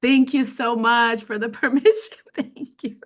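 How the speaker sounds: emotional, laughing thanks. Real, not acted. Presenter thanking a listener for permission to feel, laughing emotionally. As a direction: thank them through a laugh while still moved.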